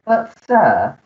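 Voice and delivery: usual speaking voice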